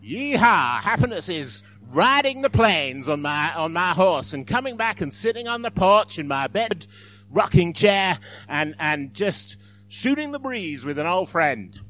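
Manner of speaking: loud, hammed-up Texan cowboy; mock Texan accent. Joke's Texan defining happiness, hammed-up American accent. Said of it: "I can't even do an American accent now".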